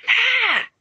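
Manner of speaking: high sudden yelp of alarm; startled yelp. Acted. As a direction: yelp as you topple over. Sirius falls with a cry.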